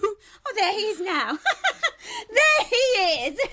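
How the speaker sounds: laughing wildly, half crying; hysterical laughter. Acted. Daughter laughing hysterically as her father arrives. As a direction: break into frantic laughter over the words.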